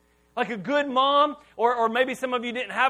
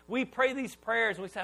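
normal talking voice

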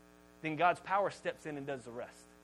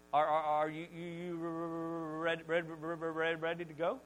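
imitated heavy, dragging stammer; stuttering. Mom asking dad to leave the hot tub, mock stuttering. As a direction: get badly stuck on syllables, mock stutter. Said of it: "she starts stuttering".

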